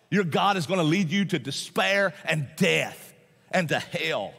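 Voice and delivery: loud, building pulpit declaration; preaching. Acted. Pastor telling the congregation to confront other faiths, forceful preaching. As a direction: hammer each line from the pulpit.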